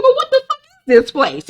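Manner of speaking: very high squeaked disbelief; incredulous outburst. Retold or imitated. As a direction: squeal the question in mock shock. Janine acted, baffled at clinic.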